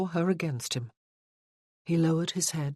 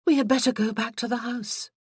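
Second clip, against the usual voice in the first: brisk and a little higher; 7 semitones higher, 4 dB louder than usual. A narrator's reading. Heroine briskly cutting the moment short, brisk. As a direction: briskly move things along. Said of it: "she said briskly".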